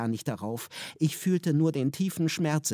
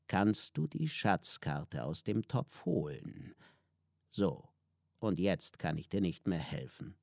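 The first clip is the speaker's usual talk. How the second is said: lower, softer, grave mentor voice; solemn Gonomi; 6 semitones lower, 6 dB quieter than usual. A narrator's reading. Gonomi explains the pot of truth, low and grave. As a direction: warn gravely and quietly.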